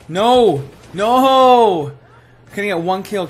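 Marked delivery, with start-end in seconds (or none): 0.1-1.9 loud, high cries of dismay; frustrated wail. Real, not acted. Gamer keeps missing shots, frustrated wail. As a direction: moan loudly at your own bad aim.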